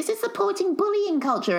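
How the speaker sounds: high-pitched mimicked complainer; high mock-indignant protest; mock outrage. Mimicking outraged complainers about the show.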